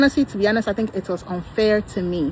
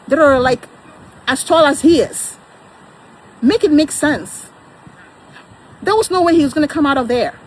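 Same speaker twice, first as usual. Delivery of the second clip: high, loud, indignant rant; exasperated outburst; 8 semitones higher, 6 dB louder than usual. Real, not acted. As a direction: let the frustration burst out, pitched up and loud. Heated rant about husband's risk.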